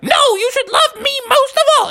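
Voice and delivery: very high, squeaky wife voice; indignant wife. A narrator's reading. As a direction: put on a high-pitched wifely protest. Wife objects in the story.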